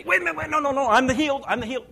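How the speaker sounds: high, loud, repeated denials; hammed-up panicky self-correction; mock frantic retraction. Pastor mimics believer answering how they feel, frantic high retraction.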